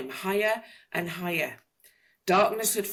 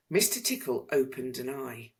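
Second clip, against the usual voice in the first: ordinary delivery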